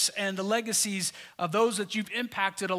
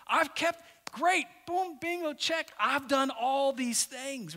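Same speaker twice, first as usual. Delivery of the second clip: mock boastful young man, higher and slow; smug rich young man; 5 semitones higher, slower than usual. Preacher imitating the deluded rich man ticking off commandments, mock boastful and slow. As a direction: tick off his good deeds like a proud checklist. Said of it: "He's like".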